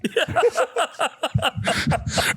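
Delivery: high, soft stifled giggling; quiet suppressed laugh. Retold or imitated. Dad hides laughter from crying daughter, quiet shaking laugh. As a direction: laugh while trying not to be caught. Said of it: "I got the quiet laugh going on".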